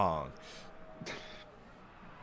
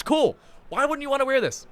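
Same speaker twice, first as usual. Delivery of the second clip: excited; 12 semitones higher, 5 dB louder than usual. Real, not acted. Showing off merch, rising.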